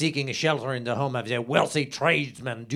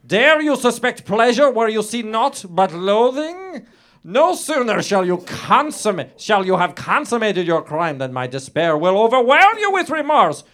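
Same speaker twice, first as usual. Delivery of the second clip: impassioned plea; 9 semitones higher, 7 dB louder than usual. Acted. Heroine's climactic plea.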